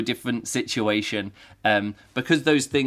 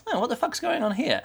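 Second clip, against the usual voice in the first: puzzled; 12 semitones higher than usual. Retold or imitated. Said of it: "And then it very quickly goes on to".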